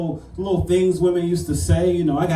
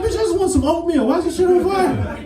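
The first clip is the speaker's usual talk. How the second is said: exasperated mock outburst; 11 semitones higher than usual. Imagined exasperated partner.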